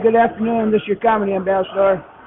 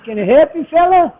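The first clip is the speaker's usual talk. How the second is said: rising, louder call to a stranger; old farmer hailing; 6 semitones higher, 6 dB louder than usual. Retold or imitated. Old farmer hails the driver.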